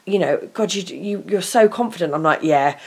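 ordinary talk, nothing marked

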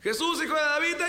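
high cry, cut off midway; grito interrumpido. Acted. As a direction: call out high until someone hushes you. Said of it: "empezó a gritar". (grito agudo que queda cortado)

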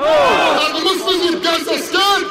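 high, forceful challenge to the crowd; rallying shout. Real, not acted. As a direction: fire the question out for a roared answer.

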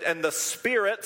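usual speaking voice

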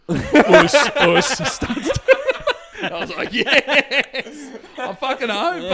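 high, loud, laughing through words; cracking up; laughing hard. Real, not acted. Laughing after the gym quote.

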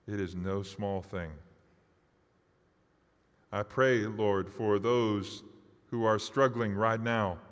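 fervent pastor's prayer; earnest prayer. Acted. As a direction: pray aloud for the congregation. Closing prayer.

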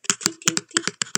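high, short glass tinks; imitated tinking. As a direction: imitate little ticks of cracking glass. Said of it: "like that sound".

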